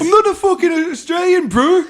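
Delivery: very high, outraged Kiwi caricature; hammed-up indignant protest; mock offended Kiwi. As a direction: join in the mock outrage, voice pitched right up. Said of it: "if you are a Kiwi".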